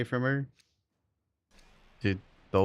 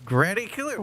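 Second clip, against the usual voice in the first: squeaky high disbelief; shocked; 12 semitones higher than usual. Real, not acted. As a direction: voice jumps up in shock. Shocked at chat name.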